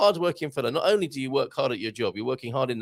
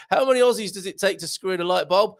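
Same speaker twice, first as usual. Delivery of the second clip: shouted joke; 13 semitones higher, 6 dB louder than usual. Acted. Telling joke, shouted punchline.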